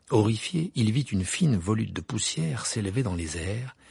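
usual speaking voice

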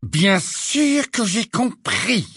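slow, tight, muffled anger; through clenched teeth. A narrator's reading. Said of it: "dents si serrées que sa voix sembla un peu étouffée".

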